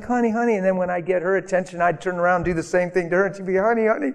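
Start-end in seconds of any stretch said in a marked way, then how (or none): 0.0-0.6 lightly mocked coaxing, pitched higher; imitated pleading. Coaxing wife during silent treatment. 3.6-4.1 wife's coaxing, lightly mimicked higher; imitated pleading. Wife coaxing back, retold.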